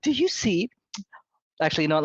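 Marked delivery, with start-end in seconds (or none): 0.0-0.7 high, brief scolding question; first-try committee head. Acted. Committee head pointing at crying children, high reproof. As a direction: pitch the demand high, still half in the cartoon voices. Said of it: "Let me do a more of Emily Gilmore voice".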